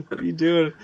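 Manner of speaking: usual speaking voice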